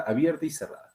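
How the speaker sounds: ordinary delivery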